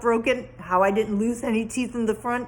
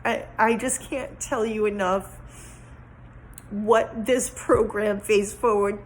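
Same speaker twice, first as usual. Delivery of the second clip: ordinary talk, nothing marked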